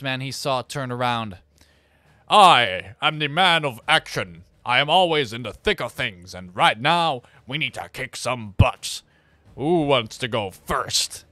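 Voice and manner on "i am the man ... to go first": slow, booming action-man voice; big slow hero voice. Acted. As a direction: play a swaggering action hero, drawn out. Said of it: "it's more of a Duke Nukem voice".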